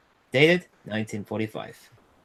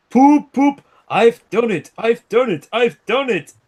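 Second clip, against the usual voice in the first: much higher and louder triumphant hoot; proud triumph; 9 semitones higher, 11 dB louder than usual. A narrator's reading. Gordon reaches the hilltop, loud high proud cheer. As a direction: crow with delight at the top. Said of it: "he said proudly".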